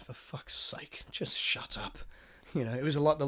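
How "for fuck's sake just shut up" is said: slow, exasperated whisper; whispered inner thought. Retold or imitated. Passenger silently wishing the chatty stranger would stop, whispered. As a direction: whisper it as a private plea. I was thinking.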